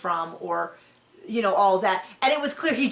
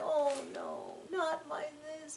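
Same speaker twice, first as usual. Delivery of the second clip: soft, higher mock whimper of a crying kid; imitating sobbing child; 6 semitones higher, 13 dB quieter than usual. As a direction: lightly mimic a child's sad wail. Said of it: "he went to bed and like".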